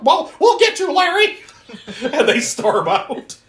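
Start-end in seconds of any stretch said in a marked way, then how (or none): 0.0-1.4 very high, mock-menacing squawk; hammed-up indignant busybody; Karen threat. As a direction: cluck a threat as you flounce off. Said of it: "And they're like".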